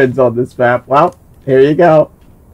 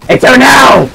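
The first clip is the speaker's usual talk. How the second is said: shout; 8 semitones higher, 5 dB louder than usual. Real, not acted. Shouting after dying.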